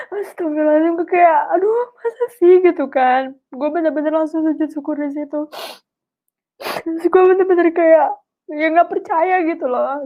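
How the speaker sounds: overwhelmed, talking through tears; tearful disbelief. Real, not acted. Speaker unable to believe Allah's gift, crying.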